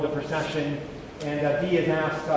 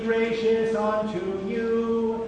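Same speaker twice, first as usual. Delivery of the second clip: chanted blessing in a reverberant church; cathedral echo; 5 semitones higher, slower than usual. Acted. Benediction sung, echoing church.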